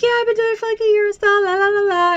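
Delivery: high, airy brush-off of the question; tossing off a casual fake answer; mock breezy high voice. Driver brushing off a nosy passenger, high and airy. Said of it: "I'm like".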